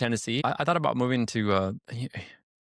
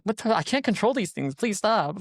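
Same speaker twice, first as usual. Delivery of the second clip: pleading younger self; 10 semitones higher than usual. Retold or imitated. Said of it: "I'm like".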